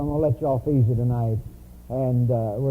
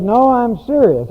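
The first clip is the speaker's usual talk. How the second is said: mock earnest insistence, louder and higher; insistent wife; 7 semitones higher, 7 dB louder than usual. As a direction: play the wife pressing him, emphatic. Wife insisting, quoted in sermon.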